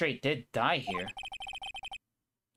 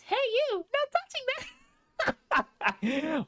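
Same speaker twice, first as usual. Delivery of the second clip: very high squeaky princess voice; Princess Peach voice. Pretend. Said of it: "I could do the Princess Peach voice".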